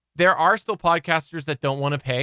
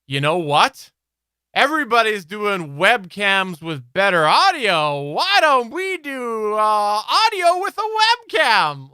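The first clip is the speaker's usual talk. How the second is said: hammed-up eureka, climbing much higher; mock brainstorm excitement; 5 semitones higher, 4 dB louder than usual. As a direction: build to a high, drawn-out mock pitch. Imagines a product team's brainstorm.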